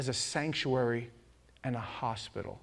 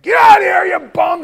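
high, full-volume mock angry scream; yelling neighbor; 18 dB louder than usual. Neighbors chase off the homeless man, angry yelling. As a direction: scream them off the property. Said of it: "neighbors yell and scream at him".

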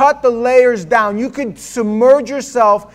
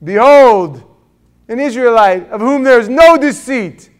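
loud, higher-pitched hailing; loud theatrical announcement; acted proclamation; 3 semitones higher, 6 dB louder, slower than usual. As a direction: proclaim it grandly to the crowd. Acts out Jesus hailing Nathanael.

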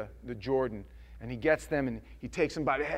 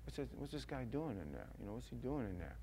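much softer puzzled onlookers; curious murmur; 2 semitones lower, 13 dB quieter than usual. Retold or imitated. Crowd watching the baptisms, murmuring softly. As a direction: mutter it like curious bystanders. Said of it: "and they see him".

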